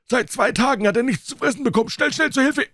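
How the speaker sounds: put-on high, loud, trembling old man's cry for help; old servant shouting for help as the dog attacks; panicked old man. A narrator's reading. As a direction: stagger in crying out in terror. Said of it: "trat taumelnd aus ... und rief".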